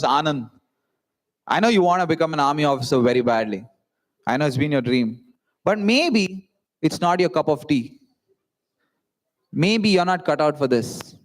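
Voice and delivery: a hint of a tender dad breaking bad news; father's gentle letdown. Dad gently voicing father's doubt.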